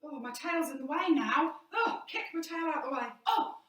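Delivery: mock flustered fuss; flustered frightened lion. Shown as a demonstration. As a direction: fumble with your tail in a panic. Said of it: "I'm frightened".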